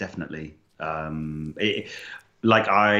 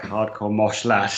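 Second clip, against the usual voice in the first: ordinary talk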